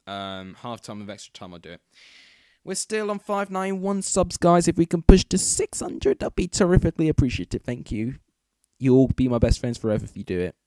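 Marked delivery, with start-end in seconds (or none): 2.6-8.2 loud, eager appeal to viewers; excited pleading. Real, not acted. Begging viewers for subscribers. 8.8-10.6 creepy friendly voice. Real, not acted. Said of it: "Sound like a creepy".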